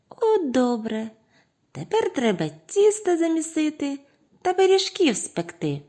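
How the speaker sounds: high squeaky chirp; little mice voices. A narrator's reading. Mice want pies baked, squeaky. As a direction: pipe it up like small mice.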